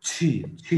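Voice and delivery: छी with a strong puff of air; aspirated chhi. Shown as a demonstration. Teacher showing students the breathy Chinese qi sound. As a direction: push air out hard on छी. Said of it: "तब उसका sound बाहर जाता है".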